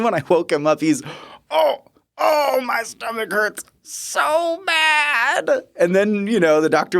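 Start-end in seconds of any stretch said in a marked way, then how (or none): 1.5-5.5 very high, slow, crying whine; high quavering moan. Retold or imitated. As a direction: ham up the patient's pitiful wail. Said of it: "quavering, high-pitched voice".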